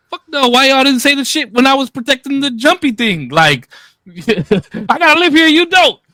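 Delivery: high, loud exasperated yelling; heated outburst at the other parents, laughing through it; indignant shout. Retold or imitated. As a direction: shout it back at them in disbelief. Arguing back at Tiara and her mom.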